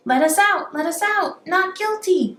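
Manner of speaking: jailed robbers shouting not guilty; prisoners shouting. A narrator's reading. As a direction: bang on the bars and yell it. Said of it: "they shout".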